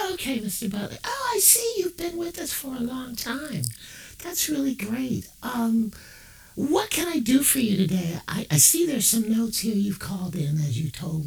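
high, bright phone-rep voice; sugary operator on the phone line; customer service rep. Acted. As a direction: put on a chirpy call-center voice. Rep voice continues.

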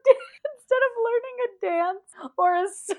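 talking through high, loud laughter; laughing. Real, not acted. Friend hears about the childhood party trick, cracking up. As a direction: squeal the words out while cracking up.

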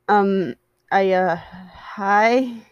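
hesitant, halting hello; awkward greeting. A narrator's reading. Virgil meets his friend's boyfriend, awkward and halting. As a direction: stumble awkwardly into a hi. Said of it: "he waved awkwardly".

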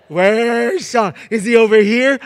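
loud, high searching call; dad pretending not to see his son, calling out loud. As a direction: shout it like a dad hunting his kid. Dad searching for the hiding child.

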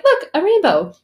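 high, loud delighted call; excited discovery. Retold or imitated. Family spotting the rainbow on the floor, delighted call. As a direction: point it out like a thrilled kid.

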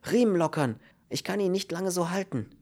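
high, strained urgency; gasping with strain. A narrator's reading. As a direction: speak while holding a heavy weight. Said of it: "keuchte er". (angestrengt keuchend)

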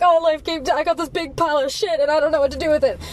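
high, whiny, helpless mock voice; mock helpless whining about life's pile; helpless victim. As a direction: whimper like a self-pitying victim, rushing the end. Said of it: "give in and be like".

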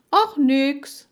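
high, loud, offhand denial; brushing off. A narrator's reading. Girl hides things from eavesdropper, brushing off. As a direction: wave the question away.

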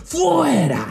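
high shouted rebuke, hammed-up; imitated pastor shouting. Imitating Central American pastors shouting in a viral video. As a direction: bellow it like a pastor casting out demons. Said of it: "es un video de unos pastores centroamericanos".

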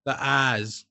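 put-on slow Boston accent with dropped R's. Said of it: "something to do with".

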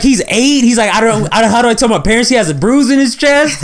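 put-on high, worried kid voice; panicked kid voice. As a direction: fret like a scared kid.

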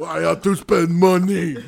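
slow, hammed-up mocking whine. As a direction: draw it out in a silly caricature. Mocking friend's stereotype voice.

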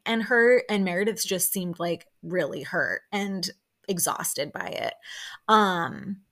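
ordinary talk, nothing marked

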